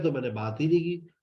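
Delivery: ordinary delivery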